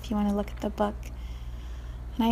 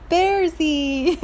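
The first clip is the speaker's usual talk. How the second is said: happy scream; 8 semitones higher, 5 dB louder than usual. Acted. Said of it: "she's screaming".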